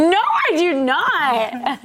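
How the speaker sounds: drawn-out, high, laughing denial; mock indignation. Real, not acted. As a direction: protest it slowly, high and amused. Playful denial of an accusation.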